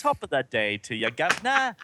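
hammed-up bad Cockney accent; bad Cockney greeting, leaping high on the last word. Host answers the teasing, hammed-up bad Cockney. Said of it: "Worst Cockney accent ever on film".

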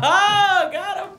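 high, laughing crow; gleeful laughing jeer. Real, not acted. Co-host cheering a joke that landed, laughing jeer.

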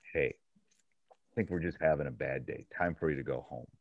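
low, soft and calm, no yelling; calm quiet tone. Retold or imitated. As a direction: quietly send a player home. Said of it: "literally in that tone".

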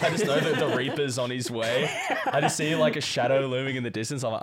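ordinary talk

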